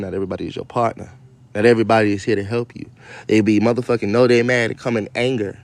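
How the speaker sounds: usual speaking voice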